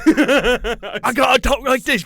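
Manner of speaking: hammed-up British accent; mock British accent. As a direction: drawl it like a posh Englishman. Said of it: "talk like that the rest of the episode".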